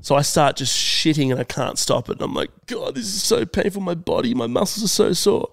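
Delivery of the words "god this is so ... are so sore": high, soft pained whine; pained complaint. Retold or imitated. Man recalling a body cramping on the toilet, pained complaint. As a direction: whimper through aching muscles. Acts out his agony mid-workout.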